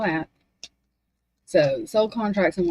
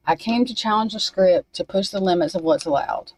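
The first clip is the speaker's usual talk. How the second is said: reading a message. Acted. Reads channeled message aloud.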